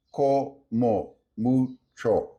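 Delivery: "Como mucho" slowly, syllable by syllable; co, mo, mu, cho: every vowel of "Como mucho" full; clearly pronounced vowels. Shown as a demonstration. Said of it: "Both vowels como are clearly pronounced".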